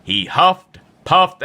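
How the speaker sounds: ordinary delivery, nothing marked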